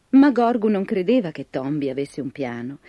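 plain reading voice

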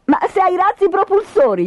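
put-on high-pitched girl's voice; bright-idea retort in a high child voice; eager girl character. A narrator's reading. Tina suggests the rockets to Michele.

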